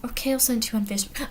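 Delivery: ordinary delivery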